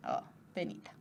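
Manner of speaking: breathy whispered pity, mock sad. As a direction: sigh it out like a fake mourner. (lástima fingida en susurro)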